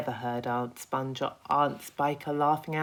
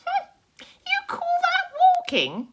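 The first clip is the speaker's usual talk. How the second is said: much higher, loud mocking bug cry; Centipede cry; 10 dB louder than usual. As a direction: scoff at the worm, pitched way up. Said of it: "cried the Centipede".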